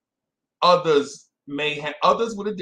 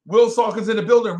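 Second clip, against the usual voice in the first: higher and louder hype welcome; excited shout-out; 6 semitones higher, 8 dB louder, faster than usual. Real, not acted. Host welcoming a viewer in chat, excited shout-out. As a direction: hail him like he just walked in.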